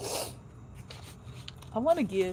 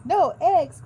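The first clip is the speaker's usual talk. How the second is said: higher and louder, an alarmed cry; dismayed cry; 8 semitones higher, 9 dB louder than usual. Acted. Farmer Brown reading the cows' note, crying out in dismay. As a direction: wail it like a farmer losing his eggs. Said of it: "cried Farmer Brown".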